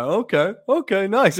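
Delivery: high, light nodding-along voice; mock-casual little acknowledgement; imitated chilled approval. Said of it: "just kinda chilling, just nodding his head like".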